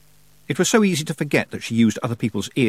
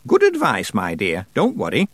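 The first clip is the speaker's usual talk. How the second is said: put-on raised, deliberate voice of an imperious old witch; old witch Miss Treason; 5 semitones higher than usual. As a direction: play the grand old witch holding court.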